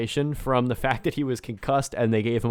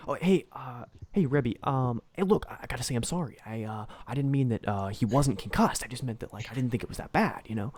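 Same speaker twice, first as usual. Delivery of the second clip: nervous apology; 6 dB quieter than usual. Retold or imitated. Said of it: "He's like".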